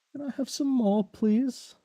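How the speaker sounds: high, soft pleading orphan voice; Oliver Twist. Retold or imitated. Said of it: "Oliver Twist?".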